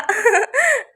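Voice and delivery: high, sly little giggle; playful scheming laugh; mischievous cackle. Acted. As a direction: giggle over a wicked idea. Aunt plots prank.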